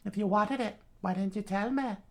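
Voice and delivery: high Southern mother voice; Southern accent. Acted. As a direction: ask the child in Southern falsetto. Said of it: "pull up my southern".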